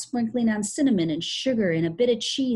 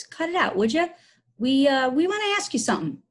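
raised, forced-hearty nervous voice; hearty quavering; 7 semitones higher, 5 dB louder than usual. Acted. Tom confronting crazy Billy, hearty but quavering. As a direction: put on bravado, pitched up and loud. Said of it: "in a hearty, quavering voice".

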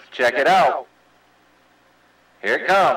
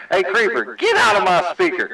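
higher-pitched playful holler; mock order; 7 semitones higher than usual. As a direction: playfully holler at a radio buddy to clear off. Playful order over the radio.

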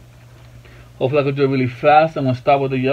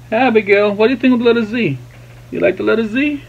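higher-pitched playful questions to an infant; 5 semitones higher, faster than usual. Real, not acted. Painting dad asks Abigail's opinion, high baby talk.

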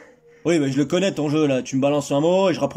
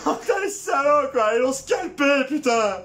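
much higher hammed-up wailing; mock grief shout; 7 semitones higher, slower than usual. Finding teammate Clémence scalped, hammed-up grief. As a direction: wail over her like a bad soap actor.

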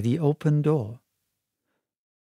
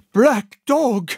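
higher and louder shocked exclamation; shocked cry; 9 semitones higher, 5 dB louder than usual. Acted. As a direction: blurt the name in fright. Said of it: "made a sort of a gasp".

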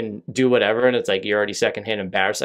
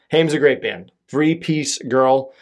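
normal talking voice

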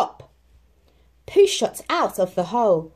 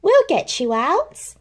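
reassuring; 10 semitones higher, 5 dB louder than usual. Acted. Christopher Robin comforts Pooh.

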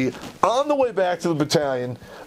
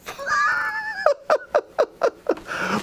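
high real laughter. Real, not acted. Storyteller laughing after his punchline. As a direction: burst out laughing.

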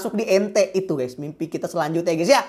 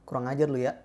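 lower, softer mock grumble at a bandmate; 6 semitones lower, 10 dB quieter, faster than usual. [name] grumbles at [name] for passing the mic, in mock scolding. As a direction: grumble it under your breath like a cheeky friend.

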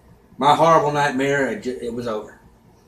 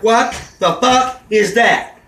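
slow, drawn-out shocked question, higher and stretched; stunned disbelief; 6 semitones higher, 4 dB louder, much slower than usual. Retold or imitated. Seeing his blind date arrive, slow stunned disbelief. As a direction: gape at her and drag every word out.

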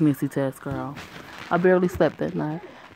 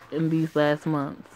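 ordinary talk, nothing marked

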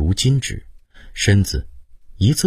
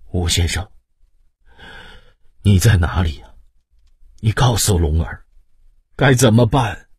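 dazed lament; 3 semitones higher, 4 dB louder than usual. A narrator's reading. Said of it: "又讷讷的道".

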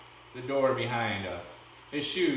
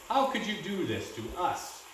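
higher, mildly upset plea; trembling voice; 5 semitones higher than usual. A narrator's reading. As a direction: plead with a touch of a tremble. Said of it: "in a trembling voice".

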